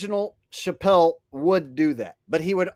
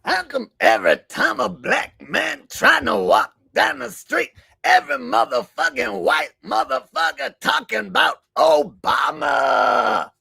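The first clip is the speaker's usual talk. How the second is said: Chris Rock; 6 semitones higher than usual. Acted. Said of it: "Haven't done Chris Rock in a while".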